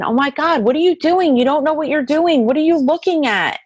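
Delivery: high, fast, wondering questions; amazed calling out. Retold or imitated. To her cousin's spirit in the room, high amazed calling. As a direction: chase a zipping light with your voice.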